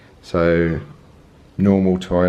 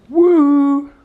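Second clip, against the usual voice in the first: high excited whoop; a happy cheer at the mirror; cheery woo while waving at the mirror; 7 dB louder than usual. Real, not acted.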